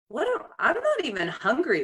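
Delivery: playfully incredulous at oneself; mock bewilderment. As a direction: catch yourself, puzzled, pitch jumping up. Acting out catching herself at fridge.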